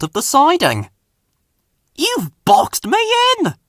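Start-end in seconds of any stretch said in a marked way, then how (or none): 1.9-3.6 higher, annoyed engine voice; a touch of steam in the complaint; mild steaming anger. A narrator's reading. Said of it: "steamed Fergus".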